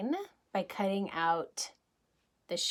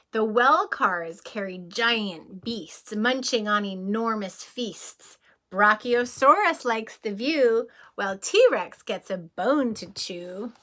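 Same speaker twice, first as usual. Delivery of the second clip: lively rhyming read-aloud, a little louder; storybook reading; 5 dB louder than usual. Acted. Reading a bedtime train book to children, lively read-aloud. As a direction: bounce through the rhyme for the kids.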